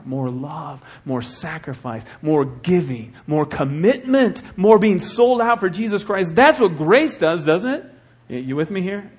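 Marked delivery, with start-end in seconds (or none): 2.2-7.9 rising from firm to near shouting; building preaching. Real, not acted. Pastor rallying his church, building to a loud cry. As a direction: build the crowd up, louder each line.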